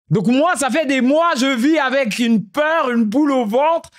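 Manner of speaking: high, slow, laughing self-mockery; laughing at himself. Real, not acted. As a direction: laugh through the confession of fear. Laughing at his own fears.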